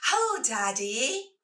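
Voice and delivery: put-on high, slow, loud little-girl call; toddler yelling. Toddler calls out to every passing man. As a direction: sing it out like a proud toddler. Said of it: "yells the middle one".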